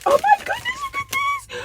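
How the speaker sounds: high, loud squeal of delight; gushing, high-pitched delight; excited squeal. Real, not acted. Thrilled on seeing the card.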